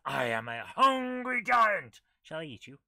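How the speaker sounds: a hint of a big hungry giant, drawn out. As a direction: boom it out slowly like a hungry giant. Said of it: "A giant voice says".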